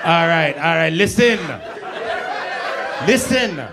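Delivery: lightly done Jamaican patois; laid-back Jamaican GPS voice; Jamaican accent. Retold or imitated. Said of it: "you won't want no Jamaican G P S".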